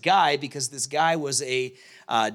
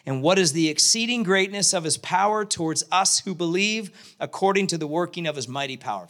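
ordinary talk

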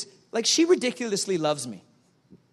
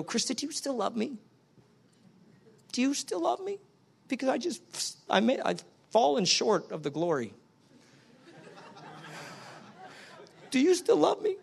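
high, soft, whiny asking; mock insecure pleading; 3 semitones higher, 6 dB quieter than usual. Husband anxiously asking wife if she still loves him, mock whiny. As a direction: ham up needy insecurity.

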